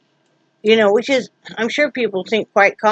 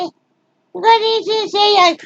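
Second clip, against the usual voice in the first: high squeaky pet voice; 12 semitones higher than usual. Real, not acted. Asking the dog Charlie to speak, high baby talk.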